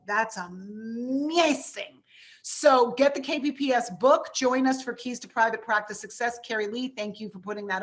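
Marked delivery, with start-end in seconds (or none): 0.1-2.0 slow, drawn-out awe; amazed. Retold or imitated. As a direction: stretch it out in wonder. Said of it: "but I was like".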